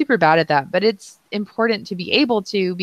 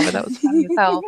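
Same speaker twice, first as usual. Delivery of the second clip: talking through real laughter, pitched up; laughing; 5 semitones higher, faster than usual. Real, not acted. Listener cracking up at co-host's joke, laughing as she talks. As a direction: let the laugh break into the words.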